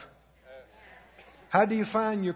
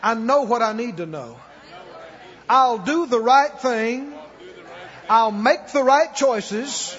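loud faith declaration; 5 dB louder than usual. Shown as a demonstration. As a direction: proclaim it so the whole room repeats. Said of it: "Say it out loud".

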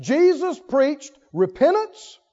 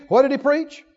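ordinary delivery

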